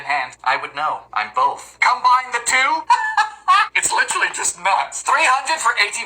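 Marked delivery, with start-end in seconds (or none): 2.8-3.7 high-pitched burst of laughter. Real, not acted. Baker laughing at a loaf of only nuts, high laugh.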